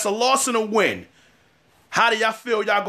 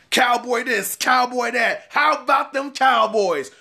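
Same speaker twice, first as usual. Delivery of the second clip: higher, sing-song fan chant; lightly hammed-up fan cheer; mocking Cowboy fans; 4 semitones higher than usual. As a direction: parody the fans' bragging.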